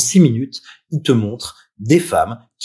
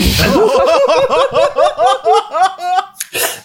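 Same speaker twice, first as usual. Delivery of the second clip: fou rire aigu. Real, not acted. Fou rire après la toux.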